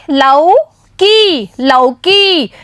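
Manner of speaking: लौ and की said apart slowly, then लौकी joined; slow syllable reading. Shown as a demonstration. Teacher sounding out लौकी slowly for children to repeat. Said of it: "अब एक साथ बोलिए".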